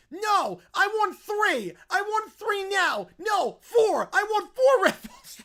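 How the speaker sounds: very high, very loud shrieking demand; screaming bratty kid. Acted. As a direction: shriek like a toddler raising the stakes. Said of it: "stop the screaming".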